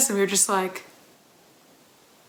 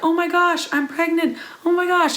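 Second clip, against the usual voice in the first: higher, excited, imitating her first reaction; retold excited squeal; 8 semitones higher than usual. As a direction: replay the giddy freak-out, pitched up. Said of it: "freaking out and crying and excited".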